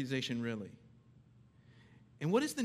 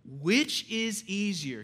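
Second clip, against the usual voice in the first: loud, slow, deliberate scripture reading; emphatic, measured proclamation; calling to attention; 4 semitones higher, 6 dB louder, much slower than usual. Real, not acted. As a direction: ring out each word to wake the listeners. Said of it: "I wanna call you to attention".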